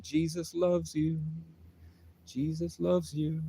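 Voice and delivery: imitating the knitting woman. Retelling a knitting woman in the hospital, soft slow imitation. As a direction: draw it out gently, high and soft. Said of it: "she was saying".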